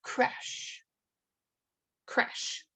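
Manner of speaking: "Creche" as KRESH, softly practised; copied pronunciation. Shown as a demonstration. Teacher practising after the dictionary app, copied pronunciation. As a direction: repeat "Creche" quietly, one syllable with final sh. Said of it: "Sometimes it'll play a pronunciation".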